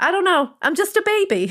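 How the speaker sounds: put-on high-pitched babyish helplessness; mock innocent baby. Mock-innocent voice of younger self.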